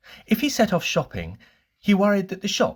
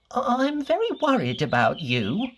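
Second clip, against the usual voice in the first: much higher, anxious fretting little voice; Mister Worry's voice; 11 semitones higher than usual. Acted. Mister Worry frets to Mister Bump in a high, anxious voice. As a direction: fret over him in a high, worried voice. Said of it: "he said".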